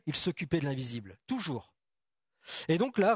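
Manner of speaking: usual speaking voice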